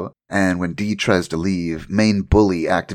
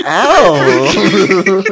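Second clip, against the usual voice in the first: loud, high, drawn-out "ow"; mock pained yelp; 12 semitones higher, 10 dB louder than usual. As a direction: yelp in pretend pain through the joke. Acts out being hurt by teeth.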